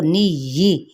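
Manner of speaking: "नी यी" said slowly with the doubled ya clearly held; tajweed pronunciation demo. Shown as a demonstration. Teacher showing students how to say "नी यी", slow and careful. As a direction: sound out "नी यी", stressing the shadda on ya. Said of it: "यी कहना है, ई नहीं कह देना".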